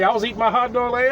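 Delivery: normal talking voice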